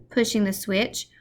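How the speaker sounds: plain reading voice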